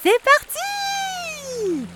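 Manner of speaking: very high, drawn-out shout; swan shouting. A narrator's reading. Swan Bianca cheering at the wheel, squealing high and loud. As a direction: launch the boat with a squealing cry. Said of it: "s'écrie".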